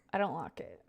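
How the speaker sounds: normal talking voice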